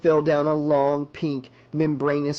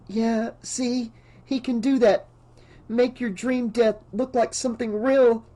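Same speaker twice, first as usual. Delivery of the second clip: high, urgent, anxious voice; frightened woman pressing her point, pitched high; panicked insistence; 8 semitones higher than usual. A narrator's reading. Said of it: "Alice's panic gathered like phlegm".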